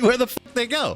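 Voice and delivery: laughing. Retold or imitated. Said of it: "he's like".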